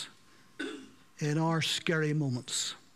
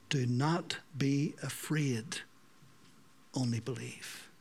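gentle reassurance; 3 semitones lower, 4 dB quieter than usual. Acted. Jesus reassures frightened Jairus.

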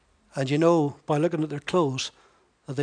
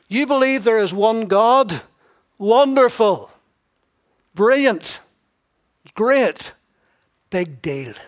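sarcastic; 4 semitones higher, 6 dB louder, slower than usual. Acted. Preacher voicing James mocking easy belief, sarcastic. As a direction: gush fake praise, then drop it dismissively. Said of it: "he's a wee bit sarcastic here".

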